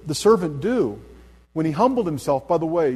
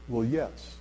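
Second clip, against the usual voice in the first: sheepish admission; 3 semitones lower, 7 dB quieter than usual. Retold or imitated. Man confessing sheepishly.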